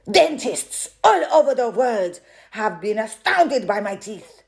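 loud boastful strongwoman; loud and proud. A narrator's reading. Strongwoman boasting to the audience, loud. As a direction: declaim it like a shout. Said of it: "She spoke very loudly".

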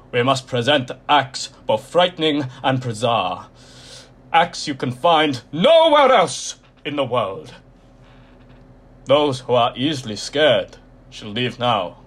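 loud, slow, croaky ringmaster warning; ominous showman warning. A narrator's reading. Mister Tall warns the timid to leave, loud and croaky. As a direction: warn them off like a sinister ringmaster. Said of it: "he warned".

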